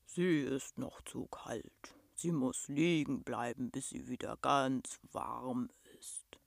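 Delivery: low, slow, gentle beast rumble; rumbling monster. A narrator's reading. Monster tells the chilled girl to lie still, slow deep rumble. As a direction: rumble it patiently, deep and drawn out.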